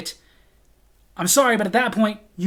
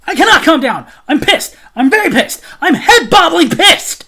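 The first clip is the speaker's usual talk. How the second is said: loud, high furious ranting; angry outburst; 7 semitones higher, 9 dB louder than usual. Retold or imitated. Customer to the manager who asks for calm, shouting angrily. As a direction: refuse to be calmed, shout it out. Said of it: "please calm down".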